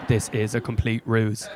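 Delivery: ordinary talk, nothing marked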